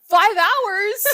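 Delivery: high, loud disbelieving gasp of a question; laughing mock disbelief; 1 mock shock. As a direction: sound floored by the flight time. Shocked retold reaction.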